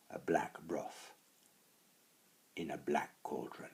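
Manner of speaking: usual speaking voice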